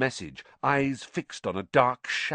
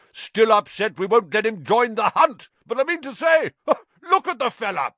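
much higher, loud, chuckling mockery; laughing shout; 12 semitones higher, 9 dB louder than usual. A narrator's reading. Sir Patrick's head mocks Nick to Harry, loud laughing shout. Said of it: "shouted Sir Patrick's head".

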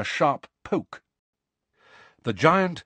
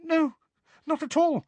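very high little squeak; tiny high-pitched nervous voice. A narrator's reading. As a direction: squeak out a polite reply. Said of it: "squeaked Hermione".

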